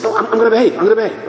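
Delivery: high, repetitive mock pleading; acted desperate assurance; pleading promise. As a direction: promise hastily to stay out of trouble. Acted student promising to behave.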